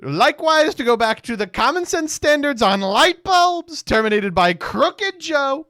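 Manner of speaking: very loud, high-pitched mock decree; hammed-up, blaring official proclamation; Donald Trump. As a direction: shout it like an all-caps post. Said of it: "Like, this is Trump".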